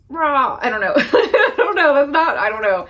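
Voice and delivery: loud embarrassed laughter over the words; laughing. Real, not acted. To viewers, admitting she's not raw, laughing loudly. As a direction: laugh it off, flustered.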